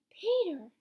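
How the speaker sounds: high, loud hail; calling out. A narrator's reading. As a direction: call his name out in relief. Mother hails her son on stopping.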